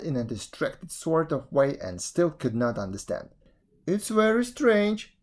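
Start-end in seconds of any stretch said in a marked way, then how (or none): 3.9-5.2 high, slow puzzlement; mildly sad jackal. A narrator's reading. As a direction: lament it in a high voice. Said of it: "said the jackal sadly".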